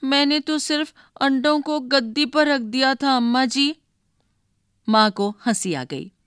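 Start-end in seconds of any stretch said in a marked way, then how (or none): 0.0-3.8 put-on higher, pleading boy on the verge of crying; boy pleads with his mother, close to tears; whiny near tears. A narrator's reading. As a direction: plead your innocence with a crying face. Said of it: "रोनी सूरत बनाकर बोला".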